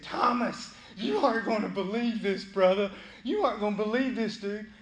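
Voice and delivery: loud, high excited announcement; excited friends. Acted. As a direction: shout the news to Thomas. Disciples excitedly telling Thomas.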